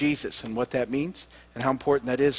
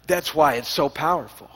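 ordinary delivery

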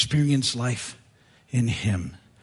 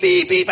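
high, louder beeps like a horn; imitated car horn; 5 dB louder than usual. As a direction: toot it out high. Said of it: "car honking".

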